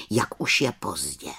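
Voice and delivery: usual speaking voice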